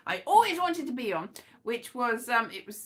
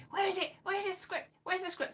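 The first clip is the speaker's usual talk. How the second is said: higher-pitched mock pestering; impatient nagging; 7 semitones higher, 5 dB quieter than usual. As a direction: pester someone for it in a raised pitch. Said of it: "He's like".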